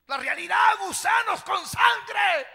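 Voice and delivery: horrified shout. Real, not acted. Preacher shouting the gruesome detail. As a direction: cry out the horror.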